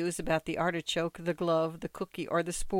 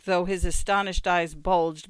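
ordinary delivery

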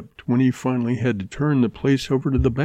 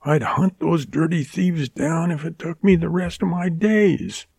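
gritted vow; 8 semitones higher than usual. A narrator's reading. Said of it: "she gritted".

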